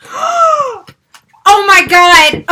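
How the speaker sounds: loud, high gasped disbelief; stunned excitement. Real, not acted. Unboxer opening the deluxe box, stunned excitement. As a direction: gasp it out, loud and amazed.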